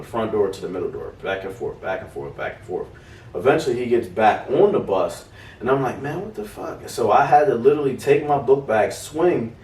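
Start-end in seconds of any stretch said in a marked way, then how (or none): 6.0-6.9 high but hushed disbelief; soft, stunned outburst; exasperated mutter. Retold or imitated. Exasperated inner thought.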